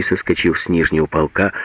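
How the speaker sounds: usual speaking voice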